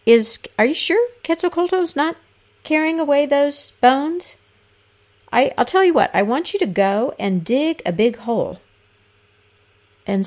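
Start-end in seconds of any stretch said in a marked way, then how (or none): 0.0-8.6 suspicious lord of the dead. A narrator's reading. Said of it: "asked his servants".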